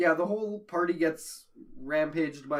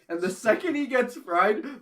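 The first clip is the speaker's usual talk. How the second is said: ordinary delivery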